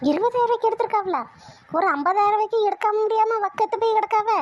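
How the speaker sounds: high-pitched scornful disbelief; indignant outrage. Acted. Grandmother outraged at cheap saree.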